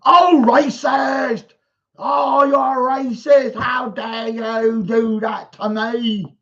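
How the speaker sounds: slow, whiny mock crying accusation; mock outrage. Mocking Finger Spectre wailing at the Photoshop, mock crying outrage. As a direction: wail it like a hurt hypocrite. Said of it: "What do you think will happen".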